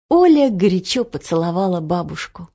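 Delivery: plain reading voice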